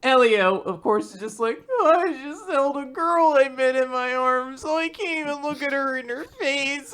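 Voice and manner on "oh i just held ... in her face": high-pitched comic fluster; hammed-up bashful voice; flustered character. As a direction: play a smitten, embarrassed lad up high. Acts flustered character after holding a girl.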